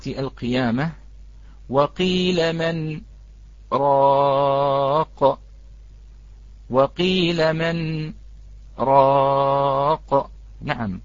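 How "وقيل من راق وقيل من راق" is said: slow chanted tajweed recitation with a pause before راق; تلاوة مرتلة بطيئة مع سكتة; Quranic recitation. Shown as a demonstration. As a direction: recite slowly, holding each sound.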